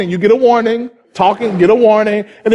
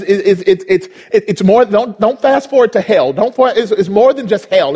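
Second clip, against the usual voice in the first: ordinary talk, nothing marked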